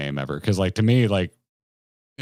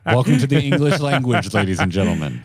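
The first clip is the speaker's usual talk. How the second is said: mock announcer; 5 dB louder than usual. Co-host teasing his friend's English, mock announcer. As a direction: announce it grandly to a crowd. Mock welcome after the correction.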